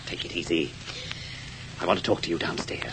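low quiet warning; hushed. Acted. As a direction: keep your voice down. Nephew hushes her.

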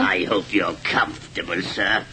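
low sneering parody of his nephew; mocking imitation. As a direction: echo it back with scorn. Uncle mocks nephew's words.

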